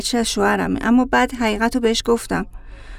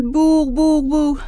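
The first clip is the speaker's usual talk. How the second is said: car horn mimicked by voice; imitated car horn; 4 semitones higher, slower than usual. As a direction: honk like a passing car, slightly higher. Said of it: "بعد یه ماشینی اومد".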